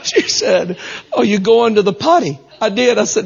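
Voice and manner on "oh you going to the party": high, imitated Northeastern accent; party turned into potty, Northeast accent. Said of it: "heavy accented women".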